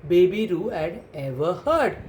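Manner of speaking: usual speaking voice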